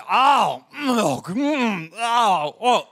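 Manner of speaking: uninhibited eating noises, loud; loud food moans. Retold or imitated. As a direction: let the whole table hear you enjoy it. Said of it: "So I".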